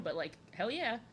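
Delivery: ordinary talk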